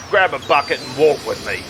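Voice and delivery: ordinary talk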